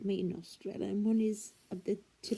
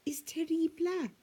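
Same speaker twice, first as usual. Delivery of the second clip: high, lilting mock-Italian; put-on Italian accent, pitched up; Italian film accent; 7 semitones higher, 7 dB louder, faster than usual. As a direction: imitate an Italian film line. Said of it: "I heard it on a, on an Italian film".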